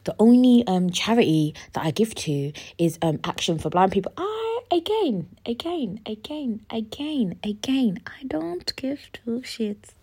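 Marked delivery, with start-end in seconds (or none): 4.2-9.9 repeated word fading into a whisper. Real, not acted. Confiding her indifference, fading from voiced to whisper. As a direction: trail off to a whisper.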